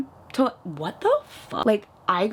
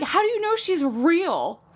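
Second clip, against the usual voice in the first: high, slow, incredulous mock panic; hammed-up worried relative; imitated freak-out; 9 semitones higher than usual. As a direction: stretch the question out in a high alarmed voice. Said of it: "freaking out".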